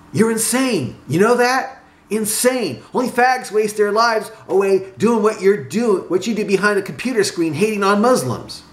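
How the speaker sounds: high-pitched scornful rant; worked-up, insulting harangue; heated tirade. A narrator's reading. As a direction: berate your friend, pitch held high. Acted tirade at friend.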